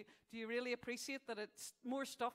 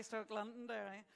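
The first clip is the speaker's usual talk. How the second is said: ordinary talk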